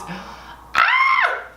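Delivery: long, high squeal of gratitude; overwhelmed squeal. Real, not acted. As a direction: let out a drawn-out happy shriek. Overwhelmed thanking viewers.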